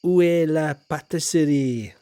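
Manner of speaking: loud, proud French accent, put on; schoolboy French. Shown as a demonstration. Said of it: "my accent in French is very good".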